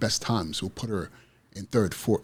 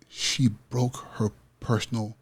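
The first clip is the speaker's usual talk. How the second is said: normal talking voice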